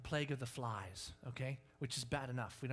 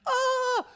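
high, loud startled yell; 14 dB louder than usual. Retold or imitated. Person swarmed by mosquitoes, yelling in alarm. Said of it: "And then you're like".

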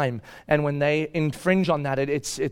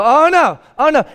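alarmed; 9 semitones higher, 8 dB louder than usual. Retold or imitated. Father reacting to daughter unravelling.

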